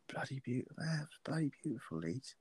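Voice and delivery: hushed, breathy murmur of longing; dreamy whisper. Retold or imitated. Homesick drunk old Englishman whispering about Leeds to the barman, hushed. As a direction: softly whisper it, lost in nostalgia.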